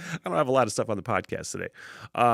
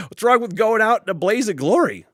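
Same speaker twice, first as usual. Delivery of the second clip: usual speaking voice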